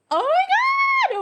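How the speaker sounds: very high, loud, slow "Oh my God"; thrilled shriek. Real, not acted. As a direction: squeal it out, overwhelmed with delight. Thrilled by her own look.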